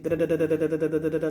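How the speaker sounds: rapid-fire da-da-da chatter; imitating racing brain. Speaker mimicking a brain that is always on, rattling. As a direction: rattle off nonstop syllables like a motor. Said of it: "my brain be just on, like".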